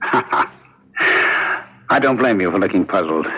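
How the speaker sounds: a laugh, then slow, amused words. Acted. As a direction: savor his puzzlement, slowly. Killer amused at warden's confusion.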